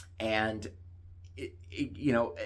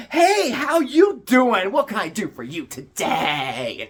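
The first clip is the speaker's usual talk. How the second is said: much higher, louder, slow, sing-song chirp; mock perky greeting; 9 semitones higher, 9 dB louder, slower than usual. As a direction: play the bubbly new hire, overly cheery. Mocking the perky new girl.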